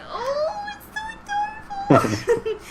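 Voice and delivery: high squeal of delight, close to tears. Retold or imitated. Fan gushing to a friend about a cute scene, squealing. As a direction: gush over something adorable, voice jumping up. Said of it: "I'm like".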